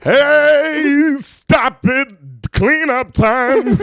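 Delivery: high, loud and very slow mock teacher scold; preschool teacher. Pretending to be a preschool teacher at cleanup, mock scold. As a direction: scold the toddlers as a stern teacher, hammed up.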